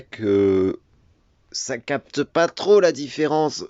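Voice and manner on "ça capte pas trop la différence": higher, lightly put-on roleplay voice; Henry's voice. Shown as a demonstration. Showing viewers his Henry character voice. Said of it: "Là, c'est la voix de Henry".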